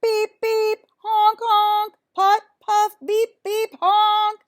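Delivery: high, loud honking imitation; cartoon beep and honk calls; loud horn noises. A narrator's reading. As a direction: blare it like a tractor horn. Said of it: "As loud as Otis could muster".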